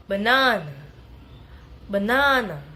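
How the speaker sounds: "banana" with middle NA loud and long; light BA, stretched NA, falling end on "banana"; stress on second syllable. Shown as a demonstration. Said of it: "primary stress in the second syllable".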